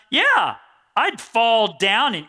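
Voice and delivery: ordinary talk, nothing marked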